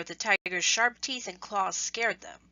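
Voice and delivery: ordinary delivery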